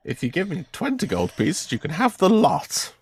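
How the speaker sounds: high-pitched haggling wizard; name your price in a high wizard voice; wizard shopkeeper. Acted. Wizard haggling with customer over crystals, insistent.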